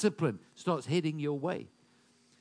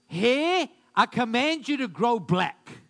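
loud, raised-pitch imitation of a commanding declaration; mock command; 5 semitones higher, 7 dB louder than usual. Preacher imitating a man addressing his mirror, mock command. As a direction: playfully order it with full preacher authority.

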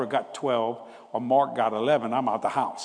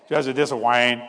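loud, emphatic brother voice; belted-out phone greeting, imitating his brother; Wayne; 6 dB louder than usual. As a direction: announce yourself loudly, like his brother on the phone. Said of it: "That's how he talked".

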